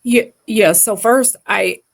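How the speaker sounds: normal talking voice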